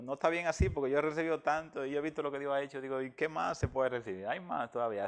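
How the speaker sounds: choked up, pushing through real tears; crying. Real, not acted. Telling his friend about the baptism while really crying, in a loud tearful outburst. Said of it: "Así como estoy ahora llorando".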